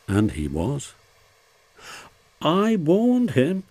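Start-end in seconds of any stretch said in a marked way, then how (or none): 2.4-3.6 high, slow, cross complaint. A narrator's reading. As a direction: insist crossly, drawing it out. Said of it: "said Rusty crossly".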